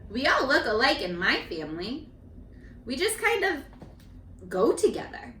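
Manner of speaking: gentle storybook reading; reading to children. A narrator's reading. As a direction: read each child's page to the class. Teacher reads picture book aloud.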